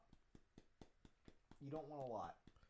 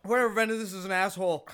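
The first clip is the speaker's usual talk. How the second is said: loud; 5 semitones higher, 9 dB louder than usual. Real, not acted. Burning from hot sauce.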